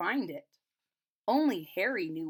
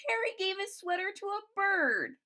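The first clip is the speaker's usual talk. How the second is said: put-on high, eager child's voice; 10 semitones higher than usual. A narrator's reading. Children explaining to grandma, high child voice. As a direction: tell the discovery with delight.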